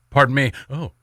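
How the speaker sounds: ordinary talk, nothing marked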